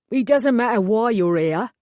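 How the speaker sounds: a burly East End mechanic taking over; East End accent. Acted. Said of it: "distinctly East End accent".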